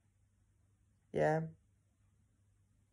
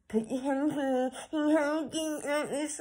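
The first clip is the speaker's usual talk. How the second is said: higher, pushy instructor imitation; mock bossy teacher; 9 semitones higher than usual. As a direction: mimic the rough guitar teacher.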